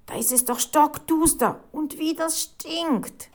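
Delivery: high-pitched, appalled complaint; horrified protest. A narrator's reading. Reporter balking at a dark, smelly cellar, horrified. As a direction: balk in dismay and disgust. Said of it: "Die Reporterin war entsetzt".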